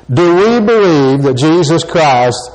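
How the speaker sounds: ordinary talk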